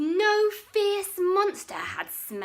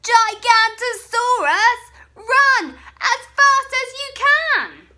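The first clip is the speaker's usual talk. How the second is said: high, loud alarm call; shout; 12 semitones higher, 15 dB louder than usual. Acted. Bonehead shouting a fake alarm at his friends, high and loud. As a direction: yell the warning across the hill. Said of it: "the cry began".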